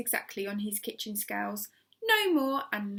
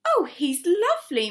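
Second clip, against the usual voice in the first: higher, louder delighted answer; fond reply; 8 semitones higher, 8 dB louder than usual. A narrator's reading. Missus Silver answering her neighbour, delighted and loud. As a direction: gush about the pet.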